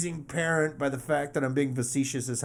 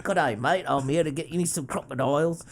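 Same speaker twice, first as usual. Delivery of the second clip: bad Australian accent, played for laughs; a hammed-up Aussie greeting; 7 dB louder, faster than usual. Podcast host mocks Crocodile Dundee, bad Australian accent. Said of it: "if any Australian person heard that".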